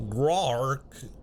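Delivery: a short guttural monster grunt; dinosaur growl. Pretend. Dinosaur player roars in character.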